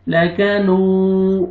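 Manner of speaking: لکنو very slow with the واو drawn long; slow chanted لکنو; stretched vowels. Shown as a demonstration. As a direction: draw out the madd in لکنو. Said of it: "نون کو آپ نے کھینچنا ہے".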